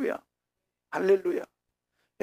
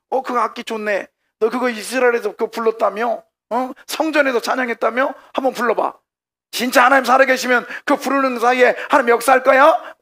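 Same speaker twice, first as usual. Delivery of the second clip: high, loud goading; mocking taunt; 8 semitones higher, 7 dB louder than usual. Preacher as Babylonians demanding songs from captives, loud jeering. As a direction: goad them into playing, building louder. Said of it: "조롱하는 거예요".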